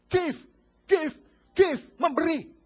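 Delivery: preacher pounding give to the congregation; emphatic repetition. Acted. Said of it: "preacher repeats word for emphasis".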